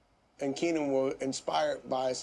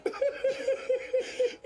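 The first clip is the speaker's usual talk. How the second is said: laughter jumps high. Real, not acted. Laughs at fight memory.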